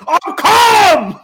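high-pitched, explosive mock-angry yell; exasperated shout. Plane passenger insisting to the marshal he is calm, exasperated shout. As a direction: blow up while insisting you're calm. Acted passenger losing his temper.

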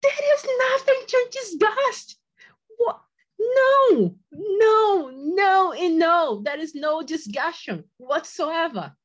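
high, loud shout on the edge of tears; retold shout. To her fiancé, refusing to discuss it: crying shout. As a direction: yell the refusal back at him, voice cracking.

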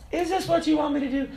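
high-pitched questioning of God; incredulous question. Retold or imitated. Man questioning God mid-thought, high incredulous voice. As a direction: ask it up high, in mock bewilderment.

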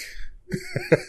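a sudden high-pitched giggle that squeaks up; high squeal of laughter. Real, not acted. Laughing at own repetition.

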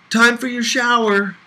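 high, loud call across the room; big sister calling the boy in for his shower, high and loud. Acted. Calling child in from outside.

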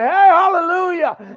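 high, ringing hallelujah shout; bold praise. Acted. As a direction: call out praise boldly. Acting out bold praise before God.

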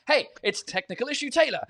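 playful show-jingle call-out; jingle announcer. Acted. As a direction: announce it like a cheesy segment intro. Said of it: "To be like".

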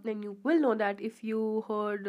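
usual speaking voice

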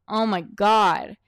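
usual speaking voice

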